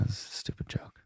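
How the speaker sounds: near-whisper, trailing away; sheepish aside. Real, not acted. Owning up to a failed pun, sheepish. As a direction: drop it to a whispered admission.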